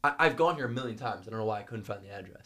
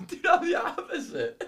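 said through real laughter, slowly; laughing it out, can barely get the words; 6 dB louder than usual. Real, not acted. Laughing to his friend about farting, said through laughter. Laughing at girlfriend fart story.

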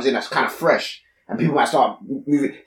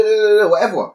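usual speaking voice

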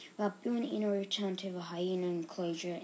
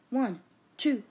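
loud, high shouted count building to three; 9 semitones higher, 10 dB louder, much slower than usual. A narrator's reading. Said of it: "shouted Betty".

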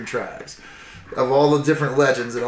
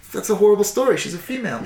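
a hint of a higher prissy voice; voicing the offended dog; 7 semitones higher, faster than usual. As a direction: play Gibbs as mildly offended. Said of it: "She's like".

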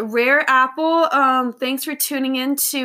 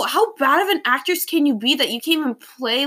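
usual speaking voice

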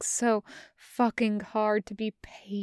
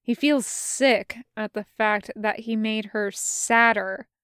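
usual speaking voice

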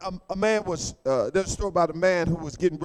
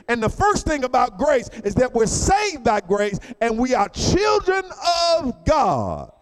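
louder, higher, shouted sermon climax; preaching at full force; 4 semitones higher, 3 dB louder than usual. Acted. Preacher challenging the congregation, fiery full-force preaching. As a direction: drive it home to the church.

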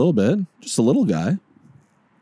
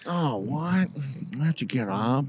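groggy sleeper; 3 semitones higher, 4 dB quieter, slower than usual. Acted. Sleepy Joker waking up.

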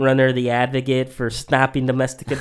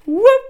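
very high, loud whoop; imitated whoop; 11 dB louder than usual. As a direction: mimic a sportscaster's shrill, rising whoop. Echoing a commentator's whoop.

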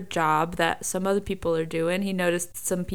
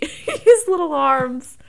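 laughing; 8 semitones higher, 7 dB louder, slower than usual. Real, not acted. Laughing fondly about the droid.

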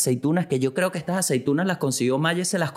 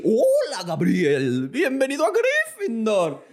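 high, slow squeaky voice with a laugh; a magic hat greeting Gabriel, pitched way up; a hot ham bread talking like the sorting hat, high and laughing; 8 semitones higher, slower than usual. Pretend. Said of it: "El pan de jamón me habló así".

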